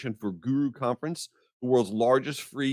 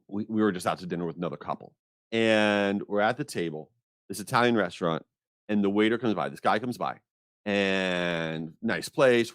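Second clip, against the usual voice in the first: normal talking voice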